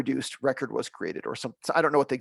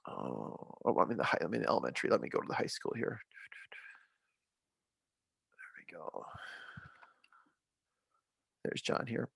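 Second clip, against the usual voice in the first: much softer, lower aside to himself; quiet muttering; 6 semitones lower, 12 dB quieter than usual. Real, not acted. Trainer mutters to himself while navigating screens, quiet. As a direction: mutter it under your breath.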